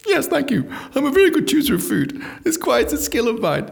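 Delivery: high smug posh voice; smug self-praise. Retold or imitated. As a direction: preen like you're a genius for ordering. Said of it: "soaking up the bullshit".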